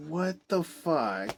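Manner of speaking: baffled at the wasted actor; slow incredulous what the fuck. Retold or imitated. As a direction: let the disbelief hang. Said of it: "It's just like".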